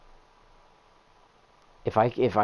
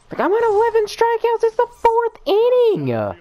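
incredulous wail; 6 dB louder than usual. Real, not acted. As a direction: whine it way up high in disbelief. Incredulous complaint.

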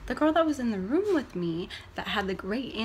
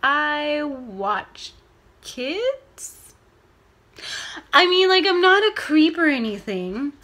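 high, loud flustered defense; mock mortified outburst; awkward blurt; 4 semitones higher, 8 dB louder, much slower than usual. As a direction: panic and overexplain.

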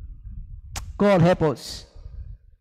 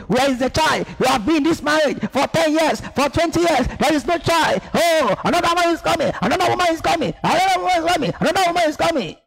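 mother-in-law scolding, pitched higher and rising; nagging mother-in-law; 7 semitones higher, faster than usual. Retold or imitated. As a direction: nag like a mother-in-law demanding a grandchild. Mother-in-law screaming at you.

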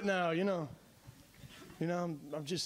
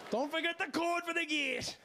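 put-on drunken late text; 7 semitones higher than usual. Late-night drunk text voiced.

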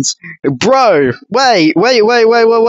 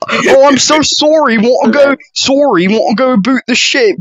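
mock Canadian accent; 3 semitones higher than usual. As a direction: parody an apologetic Canadian accent. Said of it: "of course the Canadian comes in".

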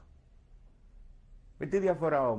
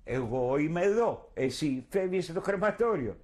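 higher, louder retold taunting call; calling out in revenge; 6 semitones higher, 3 dB louder, slower than usual. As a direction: shout it after him as he is taken away. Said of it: "και του φωνάζω". (φωνάζει ψηλά και δυνατά, με εκδίκηση)

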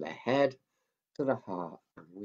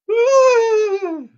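much higher, louder mock angry yell; angry puppet yell; 18 dB louder than usual. Mickey the puppet showing anger, loud high yell.

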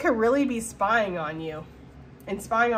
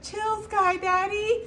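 high, slow, lightly voiced reel guy; quoting the reel; 10 semitones higher, much slower than usual. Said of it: "that reel where he's like".

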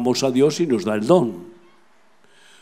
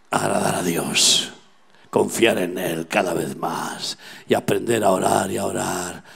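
low, hushed, intimate exhortation; tender preaching; 4 semitones lower than usual. Real, not acted. Preacher assures a friend that God listens, tender and low. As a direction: soften right down to reassure a friend.

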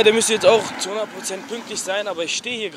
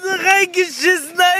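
laughing; 12 semitones higher than usual. Real, not acted. Mocking friend's botched job.

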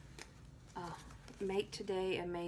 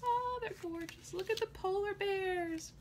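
much higher, delighted squeal; 12 semitones higher than usual. Real, not acted. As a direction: squeal over the cute bears. Delight over stationery.

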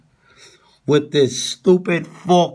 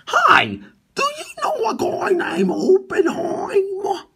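squeaky mock voice. Mock voice asking for Oppenheimer.

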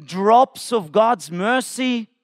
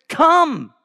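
shouting; 5 semitones higher than usual. Acted. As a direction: scream come out like a street hawker. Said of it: "He is shouting it".

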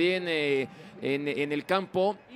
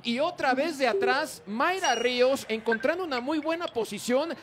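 higher, lively replay call; excited commentary; 7 semitones higher than usual. Real, not acted. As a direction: ride the excitement. (comentario emocionado)

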